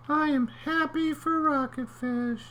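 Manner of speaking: very high little voice; comic high clam. Acted. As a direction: squeak it as the clam.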